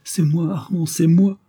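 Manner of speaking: high, urgent insistence; imploring. A narrator's reading. Count tells his wife he is Armand, imploring.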